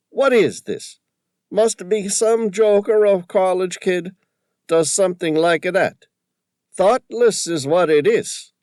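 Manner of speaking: high, loud, excited Tony voice with a put-on accent; an indignant shopkeeper squawking about the photo; riled old Tony. A narrator's reading. Shopkeeper upset at the vandal, loud high indignant voice.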